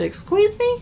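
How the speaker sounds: high, loud indignant excuse me; indignant disbelief. Retold or imitated. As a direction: fire it back in outraged disbelief. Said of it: "I said".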